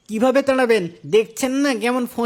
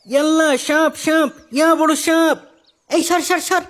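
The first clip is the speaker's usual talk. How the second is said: startled yell at the sight of a snake; frightened outcry; 5 semitones higher than usual. Acted. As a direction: cry out in fright and push everyone back. Spotting a huge snake.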